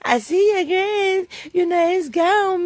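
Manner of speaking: mocking neighbor voice. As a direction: put on a high, slow mocking voice as the neighbor. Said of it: "They said my grass wasn't growing".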